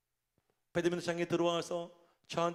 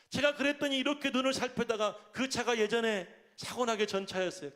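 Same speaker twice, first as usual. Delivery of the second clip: ordinary delivery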